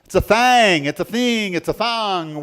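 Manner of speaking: "thing" said loudly with shifting vowels, like thang, theeng, thong; playful accent variants. Shown as a demonstration. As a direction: toss out regional versions of "thing", high and loud. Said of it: "Whatever your accent is".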